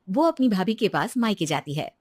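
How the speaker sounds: ordinary delivery, nothing marked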